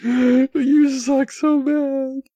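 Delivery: high, very drawn-out groaning complaint; mock lament. Real, not acted. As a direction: wail slowly at the useless software.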